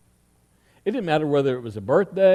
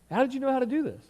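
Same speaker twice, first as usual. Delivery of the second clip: incredulous echo; 7 semitones higher, faster than usual. Retold or imitated. Echoing dad's question in disbelief.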